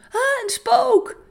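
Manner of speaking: high, slow, loud cry of fright; frightened cry. Acted. Disciples on the lake crying out in fear, high and loud. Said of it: "Ze riepen".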